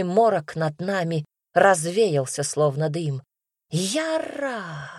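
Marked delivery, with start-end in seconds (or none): 3.7-4.9 high, drawn-out cry, held back in volume; piercing, wild cry. A narrator's reading. New cry over the battle, wild and high. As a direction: stretch the cry out high, as if far off. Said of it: "Новый крик, пронзительный и дикий".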